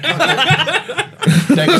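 high, drawn-out sing-song thank you; mock sweet thanks. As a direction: chirp it up high like a sweet innocent. Cop releasing killer, killer thanks.

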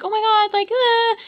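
high, panicked squeal; retold panic, high and squealing; panicked shriek. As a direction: shriek in mock panic at the leech. Retelling panic at leech.

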